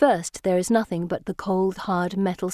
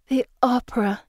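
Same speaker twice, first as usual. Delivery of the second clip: "The opera" said longingly with a falling sigh; wistful sigh; 4 semitones higher than usual. A narrator's reading. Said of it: "Pippa says with a sigh".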